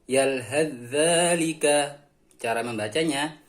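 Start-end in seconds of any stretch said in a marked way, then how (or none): 0.0-2.0 "Yalhadzalika" slowly, one doubled dz sound; sa merged into zal. Shown as a demonstration. Teacher models sa vanishing into zal, slow merged recitation. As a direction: draw out "Yalhadzalika" with no th before the dz. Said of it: "Contoh bacanya yaitu".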